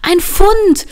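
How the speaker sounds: high, loud cry of discovery; excited shout. A narrator's reading. Line finds a coin in the snow, excited shout. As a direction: shout it out, thrilled. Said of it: "rief Line, ihre Augen leuchteten vor Aufregung".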